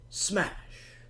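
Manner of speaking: high, breathy burst of a word; dramatic smash. Acted. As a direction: relish the crash like a sound effect. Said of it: "So loud".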